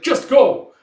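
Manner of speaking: high, loud command to leave; urgent shout. Retold or imitated. Urging the couple to flee, urgent shout. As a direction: send them off forcefully.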